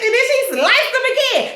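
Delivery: normal talking voice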